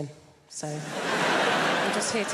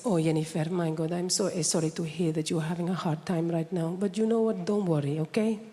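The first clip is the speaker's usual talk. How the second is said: lower, consoling Spanish mum; mum's Spanish accent; 4 semitones lower than usual. Retold or imitated. Spanish mum comforting her sad daughter on the phone. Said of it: "conversation always goes like this".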